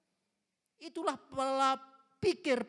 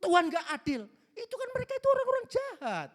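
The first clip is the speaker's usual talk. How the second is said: higher, louder complaint to God; angry complaint; 9 semitones higher, 4 dB louder than usual. Retold or imitated. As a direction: voice Jonah protesting angrily to God. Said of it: "Marah dia".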